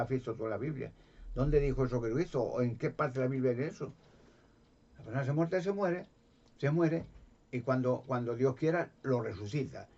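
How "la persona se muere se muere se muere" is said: "se muere" repetido, más agudo y fuerte; recalcar. Real, not acted. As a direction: insiste golpeando "se muere". Repite para recalcar. (énfasis creciente en "se muere")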